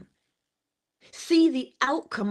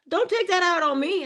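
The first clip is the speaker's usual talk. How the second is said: son's retort; 10 semitones higher than usual. Retold or imitated.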